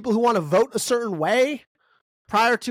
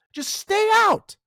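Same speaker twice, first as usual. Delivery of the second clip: emphatic demand; 11 semitones higher, slower than usual. Real, not acted. Angry demand to agencies.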